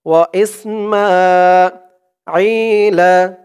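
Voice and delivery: ওয়া ইসমাঈলা chanted slowly, মা held one alif; slow model reading of ইসমাঈলা; tajweed recitation. Shown as a demonstration. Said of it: "জেরের পরে ইয়া সাকিন এক আলিফ টান".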